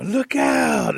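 loud, high alarm call; mock-alarmed holler; sudden warning shout. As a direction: yell it out to make everyone turn. Bat's diversion to escape.

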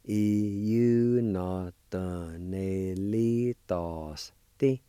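Iunotonelitos Ti said slowly, ee-yoo-nah-tuh-neh-lee-tahs-tee; slow model of Iunotonelitos Ti; slow Cherokee pronunciation. Shown as a demonstration. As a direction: stretch Iunotonelitos Ti out for learners. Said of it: "it's pronounced like this".

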